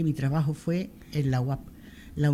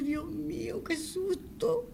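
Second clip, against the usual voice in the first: much higher, slow, half-crying; relived fright; 14 semitones higher, 7 dB quieter, much slower than usual. Real, not acted. [name] reliving the scare her colleagues' prank gave her, high and tearful. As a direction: wail it out as if still shaken.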